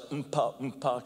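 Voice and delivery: vocal drum beat, mouthing "Mpa, mpa"; drum beat imitation. Shown as a demonstration. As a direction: tap out the beat with the voice. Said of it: "kind of beat".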